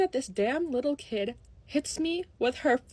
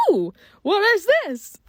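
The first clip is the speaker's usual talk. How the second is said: excited discovery; 8 semitones higher, 6 dB louder than usual. Retold or imitated. Finding hidden lip gloss.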